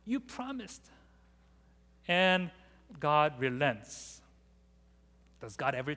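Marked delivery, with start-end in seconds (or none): 0.0-0.9 put-on childish whine. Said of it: "Moses is saying".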